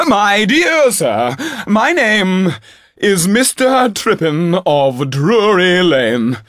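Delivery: very high, loud, slow grandiose pleading; pompous actor. Acted. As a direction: puff up like a famous stage star. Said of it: "cried the gentleman".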